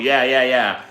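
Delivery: ordinary delivery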